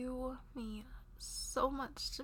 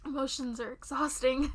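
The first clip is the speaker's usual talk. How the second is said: normal talking voice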